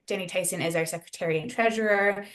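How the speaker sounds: ordinary talk, nothing marked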